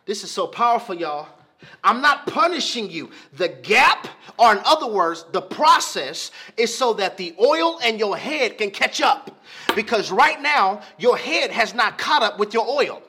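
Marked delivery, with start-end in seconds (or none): 1.8-13.0 louder, driving sermon voice; preaching as God. Acted. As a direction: preach God's word to the congregation.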